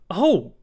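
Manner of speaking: sharp, loud, high gasp of alarm; startled cry. A narrator's reading. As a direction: cry out on seeing the enemy. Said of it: "I cried".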